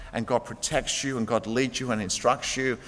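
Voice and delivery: usual speaking voice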